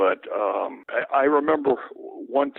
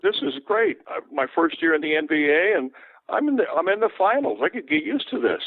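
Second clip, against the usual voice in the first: thrilled; 7 semitones higher than usual. Retold or imitated. Retelling his thrilled thought.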